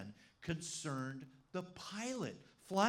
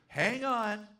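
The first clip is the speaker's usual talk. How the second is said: loud, raised imitation of a captain calling out; retold shouted warning; 5 semitones higher, 8 dB louder than usual. Captain to balloon passengers before the drop, shouted warning. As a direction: call it out like a pilot warning passengers.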